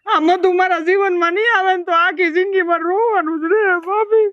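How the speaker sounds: high, tearful, voice breaking; crying complaint. Acted. Devar sobbing to his sister-in-law about Madhu, high and crying. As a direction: whine it out through sobs.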